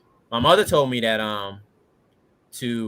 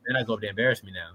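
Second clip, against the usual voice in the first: quick wife quote; much faster than usual. Retold or imitated. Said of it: "And my wife was like".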